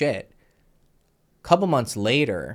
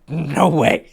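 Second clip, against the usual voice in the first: loud, higher-pitched mock refusal; retold emphatic refusal; 7 semitones higher, 8 dB louder than usual. Storyteller replaying refusing the girl, emphatic and loud. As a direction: act out shutting it down, louder and higher.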